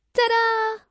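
high, bright showman's "Ta-da!"; triumphant ta-da. Acted. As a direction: present yourself like a magic trick's reveal. Triumphant self-announcement.

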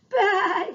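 high, loud scared baa; lamb's loud high baa; lamb's bleat. A narrator's reading. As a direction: bleat while waiting, frightened. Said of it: "he said".

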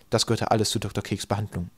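usual speaking voice

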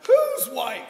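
high, loud startled yelp; sudden high exasperated squeak; squeaky surprise. Acted. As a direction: squeak it out in shock. Exasperated comic confusion.

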